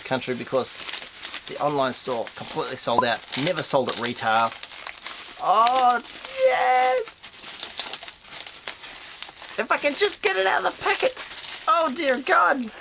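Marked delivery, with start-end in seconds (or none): 5.4-12.7 thrilled. Real, not acted. Collector finally getting the sold-out figure, high thrilled squeal. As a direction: squeal with joy over the rare figure.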